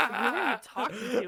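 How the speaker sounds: high laughing question; giggling through the question; laughing mock coworker. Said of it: "people walk by and be like".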